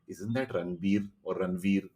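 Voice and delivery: soft, offhand and slow; casual aside. Retold or imitated. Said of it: "an actor will just walk in casually saying".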